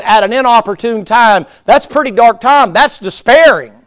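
loud, high, impassioned preaching; fiery revival preacher near a shout; preaching at full force. Acted. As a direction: pound it out from the pulpit.

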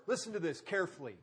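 ordinary delivery

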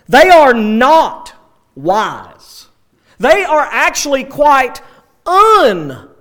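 loud, high, hammered preacher's delivery; forceful preaching. Real, not acted. Preacher shaking believers awake about false wisdom, forceful preaching. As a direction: jolt the congregation with every key word.

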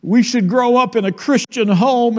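put-on high, teary little girl voice, lightly done; a hint of a sobbing child; mock sobbing child. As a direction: play the upset little girl half in jest. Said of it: "she said, sobbing".

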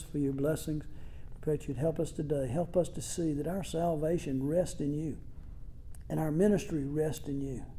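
hushed, earnest prayer voice; closing prayer. Acted. Pastor leading the church in closing prayer, hushed and earnest. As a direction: pray it softly over the congregation.

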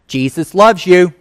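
sugary tacked-on blessing; sarcastic pious afterthought; mock sweet. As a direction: rude diner adding a fake-nice blessing. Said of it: "I look at her and go".

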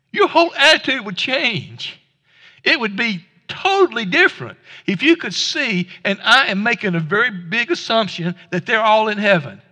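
normal talking voice

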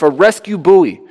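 usual speaking voice

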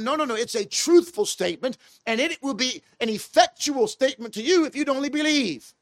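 ordinary talk

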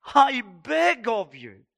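acted, imploring cry from the preacher; heartfelt begging. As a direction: beg slowly, as if crying out from the heart. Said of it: "crying out from the bottom of his heart".

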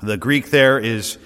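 usual speaking voice

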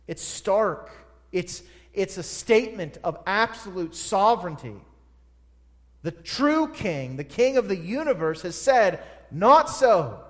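high, loud, driving pulpit voice; full-throated sermon climax; preaching at full force. Acted. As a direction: preach it out, ringing and urgent. Preaching the point of sovereignty.

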